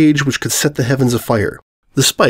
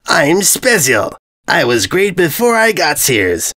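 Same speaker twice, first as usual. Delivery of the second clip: dim braggart; 6 semitones higher, slower than usual. A narrator's reading. Invader brags in dumb voice.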